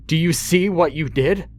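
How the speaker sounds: father yells the question high and loud; angry yell. Acted. Father screaming at his son over the dead body. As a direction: jab the words at the boy in fury.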